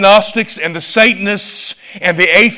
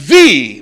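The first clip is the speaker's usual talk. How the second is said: THE drawn out slowly as "thee"; emphatic, stretched "the"; stress on THE; 3 semitones lower, 5 dB louder than usual. Shown as a demonstration. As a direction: lean long and hard on "the". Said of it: "Articles in the Bible mean something".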